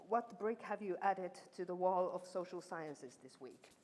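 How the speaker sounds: normal talking voice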